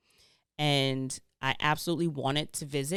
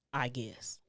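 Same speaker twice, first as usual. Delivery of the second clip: dry whispered "I guess"; whispered aside; 3 semitones lower, 6 dB quieter than usual. Real, not acted. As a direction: breathe it out as a doubtful aside. Doubtful aside about being ruled by sun.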